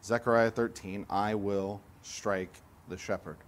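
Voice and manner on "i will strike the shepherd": solemn hush. Shown as a demonstration. Preacher repeats quote quietly, slowly.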